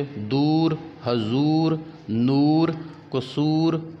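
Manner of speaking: long full "oo" in दूर, हजूर, नूर, कसूर; full open waao. Shown as a demonstration. Teacher showing waao maroof, दूर and नूर with long clear "oo". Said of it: "जो खूब खुलकर पढ़ी जाए".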